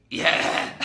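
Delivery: loud, raspy exhaled crazed "yeah"; voiceless, maniacal cartoon-villain cheer; Joker. Shown as a demonstration. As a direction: force it out like a manic villain. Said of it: "like, like the Joker".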